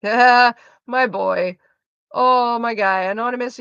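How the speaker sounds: ordinary talk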